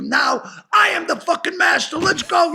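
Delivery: high, forceful order to a horse; mock commanding shout. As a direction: declare mastery with hammed-up bravado. Acts out scolding the horse.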